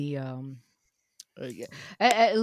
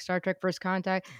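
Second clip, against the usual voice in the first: ordinary talk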